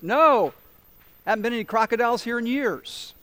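calling back. Acted. Man on shore calls back.

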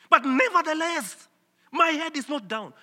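NEVERTHELESS pitched high and driven out loud; emphatic. Shown as a demonstration. Preacher voicing Paul unbowed by suffering, emphatic. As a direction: hit "nevertheless" hard, keep the pitch up through the rest. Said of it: "Greek word for it is emphatic".

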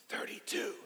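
unvoiced whisper; whispering a number. Shown as a demonstration. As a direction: whisper the age to the pastor. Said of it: "I would say".

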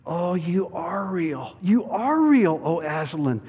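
put-on high girlish wonder, building; rising, high-pitched cry of relief; crying out as Lucy. A narrator's reading. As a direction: exclaim as an overjoyed child character. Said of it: "cried Lucy".

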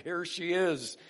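a touch of joyful exclamation; delighted discovery. Acted. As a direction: announce a happy find. Adam exclaims on seeing Eve.

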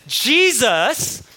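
ordinary talk

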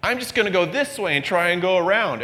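high, protesting complaint; mock-whine like a reluctant child; a hint of petulant refusal; whiny stubborn believer. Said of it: "And we go".

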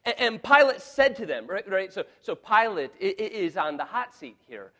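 loud, high impassioned preaching; preaching at full force. Acted. Pastor pleading Pilate's case to the congregation, loud and impassioned. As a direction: thunder it from the pulpit.

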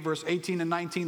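ordinary talk

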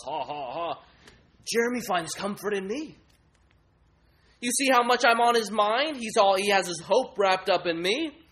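mocking laugh, then brag; house idol. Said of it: "the house idol".

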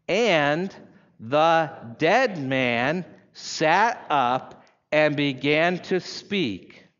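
long pauses between the words; drawn-out reading with a gap after each word; very slow, word by word. Shown as a demonstration. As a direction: read very slowly, letting each word stand alone. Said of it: "very slowly, and pause between each word".

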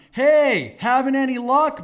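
high, loud holler across water; yelling out. Retold or imitated. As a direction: call out to men in a distant boat. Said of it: "he yells out to them".

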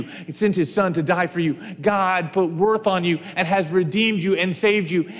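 usual speaking voice

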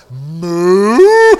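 loud comic moo; mooing cow imitation. To the waiter, a loud moo to mean beef. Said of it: "I was going".

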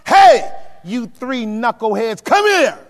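slow, loud shouting father, pitch jumping up on come here; an angry dad hollering for his three kids; Big Papa. Acted. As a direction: bellow at the kids like a no-nonsense dad. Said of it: "The Lord is like Big Papa".

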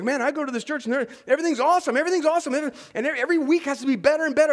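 fast, loud, gushing praise of the church; gushing churchgoer. Retold or imitated. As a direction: rattle it off like an overexcited fan of your church. Friend gushing about his church.

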